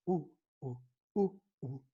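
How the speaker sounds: short rhythmic "uh" grunts; measured, repeated low-key grunt; imitated marching sound. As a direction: mimic hunters keeping focus with steady "uh, uh". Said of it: "vocês vão fazendo um som".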